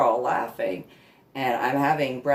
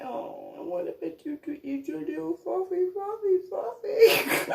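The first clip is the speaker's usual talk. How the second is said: sad puppy voice. Retold or imitated. Voicing the lying-down dog, high sad whimper. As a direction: moan like a disappointed pup.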